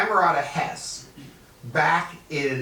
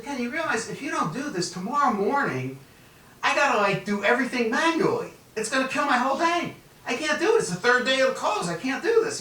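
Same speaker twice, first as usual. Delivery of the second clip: pitched way up, begging fast; high desperate pleading; 11 semitones higher than usual. Retold or imitated. Pleading with the key punch manager, high and desperate. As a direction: plead like the whole day depends on it.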